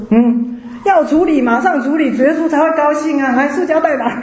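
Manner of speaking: eager urging. Retold or imitated. Deacon urging to clear idols.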